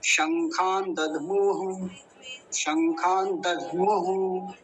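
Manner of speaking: शंखान दधमुः slowly as one phrase; slow model. Shown as a demonstration. Teacher to students: slow model of the whole phrase. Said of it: "ऐसे ही उच्चारण होगा जैसे बताया".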